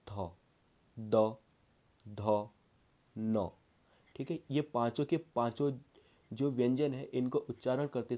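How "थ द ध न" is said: थ, द, ध, न each said alone, tongue touching the teeth; slow classroom demo of थ, द, ध, न against the teeth; dental letter demo. Shown as a demonstration. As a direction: sound out थ, द, ध, न separately like a teacher. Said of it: "जैसे मैं कहूँ त".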